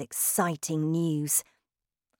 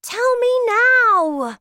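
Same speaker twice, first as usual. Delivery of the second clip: put-on high, loud, drawn-out child's demand; impatient cry; 9 dB louder, slower than usual. A narrator's reading. Boy can't wait for the answer, impatient shout. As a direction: demand it at the top of a boy's voice. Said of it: "I cried".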